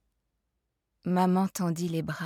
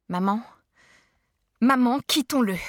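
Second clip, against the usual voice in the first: raised and louder, pleading with passion; passionate plea; 4 semitones higher, 5 dB louder than usual. A narrator's reading. Child begging mother to leave father, passionate. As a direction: beg her to leave with everything you have. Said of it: "dis avec passion".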